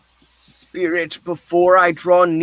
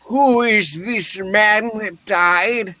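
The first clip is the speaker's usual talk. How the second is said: normal talking voice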